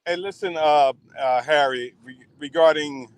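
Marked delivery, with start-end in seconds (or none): none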